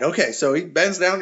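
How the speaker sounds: normal talking voice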